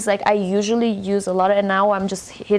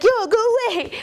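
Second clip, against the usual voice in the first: much higher, louder mock shoo-off; dismissive gesture quote; 14 semitones higher, 4 dB louder than usual. Speaker acting out a go-away gesture, high and loud. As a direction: wave someone off with your voice. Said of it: "It's like".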